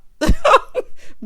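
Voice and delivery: high, loud burst of laughter; laughing. Real, not acted. Host amused by her own telling-off, laughing. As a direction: break into laughter after mock scolding.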